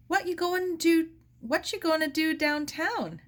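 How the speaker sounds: pry with a lightly voiced busybody; nosy streetcar woman. Nosy woman questions Sarah.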